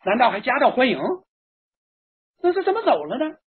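high-pitched, bewildered guests all asking at once; baffled clamour. A narrator's reading. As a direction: voice a roomful of stunned relatives. Baffled guests clamour after groom leaves.

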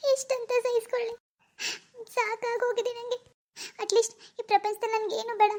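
sobbing, high-pitched lament; grief-stricken wail, words broken by sobs; tearful breakdown. Acted. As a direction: break down crying as you plead with God. Grieving mother breaks down alone.

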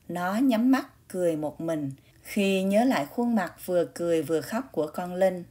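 ordinary delivery, nothing marked

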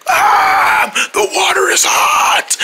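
high pained cry at the hot water; scalded yelp. Acted. King burned by hot water, yelping in pain.

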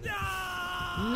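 high slow crying yell; real panicked shout. Real, not acted. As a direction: panic as the killer grabs you. Why are you screaming, [name]?.

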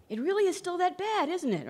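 high, mildly incredulous quote; quoting an actor. Retold or imitated. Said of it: "one of our actors said".

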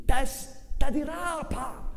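high, slow mocking shout; sling the insult like a cabman; jeering coachmen. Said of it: "gli rivolgevano il garbato appello di". (insulto beffardo dei cocchieri, acuto e lento)

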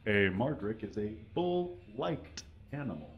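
ordinary talk

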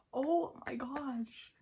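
high, slowly stretched amazement; amazed. Real, not acted. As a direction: draw it out in delighted disbelief. Reacting to the violin photo.

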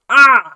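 short loud high "Ah!"; sudden yell. Real, not acted. Reviewer reacting to silver coins instead of gold, sudden yell. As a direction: burst out with a sharp shout.

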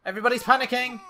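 high, loud, tense commentary; playful panic. Pretend. Gamer narrating the household in a panic, mildly frantic. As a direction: report the chaos with rising alarm.

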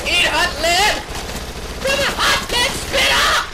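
high shout climbing to a squawk; hammed-up, high-pitched taunt; mock battle cry. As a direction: yell it like an over-the-top action hero. Battle cry while shooting zombies.

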